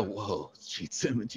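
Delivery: soft, slow, starstruck murmur; slow hushed awe at a rock idol. Retold or imitated. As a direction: breathe his name like meeting a hero. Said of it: "and I go".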